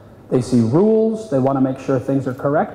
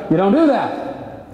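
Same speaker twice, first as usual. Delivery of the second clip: ordinary talk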